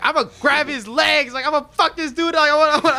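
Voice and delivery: put-on loud, high, hyped-up kid's scheme; acted fired-up boast. Kid plotting to catch Santa, acted fired-up boast. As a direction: blurt it out with mock bravado. Acting out childhood plan to ambush Santa.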